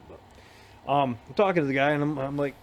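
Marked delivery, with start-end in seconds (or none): none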